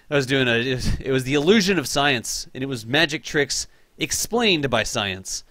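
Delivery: ordinary talk, nothing marked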